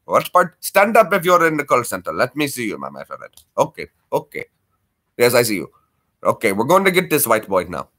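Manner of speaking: mock Indian call-center accent; Apu-style Indian accent. Call-center agent answering on the other line, mock Indian accent. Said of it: "do your Apu voice for them".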